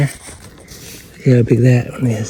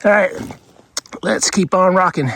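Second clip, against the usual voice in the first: ordinary delivery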